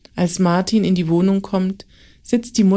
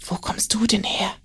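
astonished whisper; surprised whisper; 2 semitones higher, 5 dB quieter than usual. A narrator's reading. Martin asks Max where he came from, whispered surprise. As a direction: breathe the question in amazement. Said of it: "fragt Martin erstaunt".

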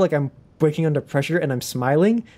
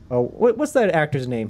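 ordinary talk

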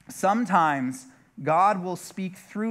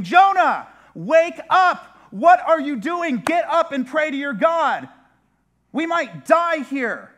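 shouted, much higher, pounding-on-the-door panic; urgent shout; 11 semitones higher, 9 dB louder than usual. Acted. Captain pounding on the door yelling at Jonah, frantic. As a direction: yell him awake, we might die. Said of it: "That's the urgency in his message".

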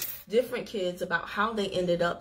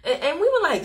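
usual speaking voice

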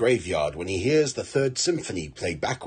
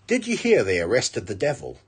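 usual speaking voice

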